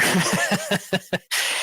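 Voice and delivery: a quick chuckle at the gag; short real chuckle. Real, not acted. Recalling Steve Martin's missing watch on the train, a real chuckle. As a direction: laugh briefly, amused.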